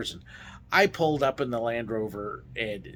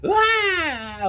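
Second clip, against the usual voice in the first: loud, high mock scream; 6 dB louder than usual. Driver faking terror at tiny drop, mock scream. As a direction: let out a hammed-up yell of fright.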